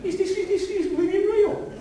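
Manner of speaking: very high, loud mock disbelief; laughing disbelief, very high; incredulous laughing. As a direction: laugh out the question in amazement. Mock disbelief at bad singers.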